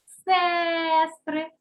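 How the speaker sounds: сестри with a long, held «е»; stressed first syllable. Shown as a demonstration. Teacher puts the vowel under stress, сестри with drawn-out «е». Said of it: "ставимо під наголосом".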